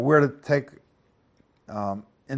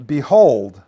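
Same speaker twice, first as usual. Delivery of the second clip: "behold" said loud, with weight; emphatic, louder "behold"; 9 dB louder than usual. Shown as a demonstration. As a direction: lean hard on "behold". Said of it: "it's, it's emphatic".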